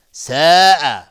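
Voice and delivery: ساء stretched four to five beats; long madd. Shown as a demonstration. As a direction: hold the vowel of ساء long and loud. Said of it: "مد واجب متصل به مقدار چهار". (کشیدن مد در ساء)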